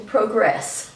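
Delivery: "progress" as proh-GRESS, long o first; alternative pronunciation. Shown as a demonstration. Lecturer joking about yet another variant, second-syllable stress. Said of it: "might even use the pronunciation".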